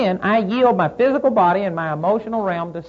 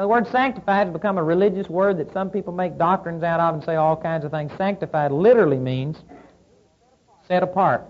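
normal talking voice

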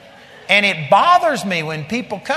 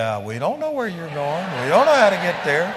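clueless disciple. Retold or imitated. Preacher mimicking Philip answering the Lord, mock clueless.

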